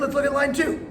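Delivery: ordinary talk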